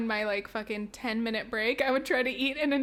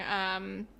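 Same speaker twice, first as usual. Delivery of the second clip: ordinary talk, nothing marked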